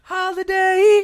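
high, slow drawn-out echo; mock it in a squeaky drawn voice; silly echo voice. Echoing holiday in silly voice.

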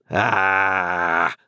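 long menacing growled "ah"; growl between teeth. A narrator's reading. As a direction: growl it out through clenched teeth. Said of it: "growled the journeyman between his teeth".

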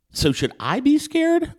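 high nervous question. Retold or imitated. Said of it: "I said".

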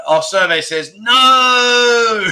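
slow, loud announcer drawl on the verdict; game show host. Pretend. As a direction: boom it out like a game show reveal.

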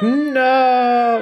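very high, loud drawn-out cry; mock horror. Playing colleague's reply to pirate boss, mock horror. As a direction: wail it in exaggerated dread.

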